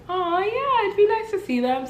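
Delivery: high-pitched, sing-song yeah; acted agreeable reply. Girlfriend agreeing to see a missed friend, agreeable. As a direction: pitch it way up, politely keen.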